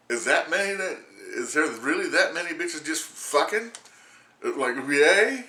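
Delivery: high, loud mock disbelief; incredulous. To Arlene about the news, loud incredulous disbelief. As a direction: ask it like you can't believe it.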